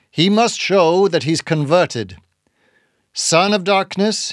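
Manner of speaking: a hint of a hollow, preaching captain; hollow-voiced old captain. A narrator's reading. Bildad demanding proof of conversion, hollow and insistent. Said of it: "in his hollow voice".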